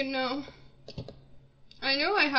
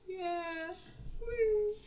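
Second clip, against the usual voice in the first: ordinary delivery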